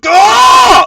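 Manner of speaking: high, loud happy scream; a burst of hype; excited yell. Pretend. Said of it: "let's bring the mood back up".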